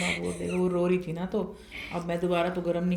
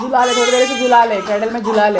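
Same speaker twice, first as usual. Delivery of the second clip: raised voice, much louder, urging someone to rock the cradle; urgent shout; 3 semitones higher, 11 dB louder than usual. Real, not acted. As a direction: shout it across the room so they hear you. Urgent call over crying baby.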